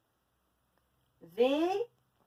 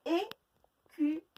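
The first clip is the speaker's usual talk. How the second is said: Écureuil drawn out slowly, syllable by syllable; 5 semitones higher, much slower than usual. Shown as a demonstration. Teacher counting syllables for pupils, slow and broken up. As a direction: stretch Écureuil into its three beats. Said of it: "Je vous laisse chercher le nombre de syllabes".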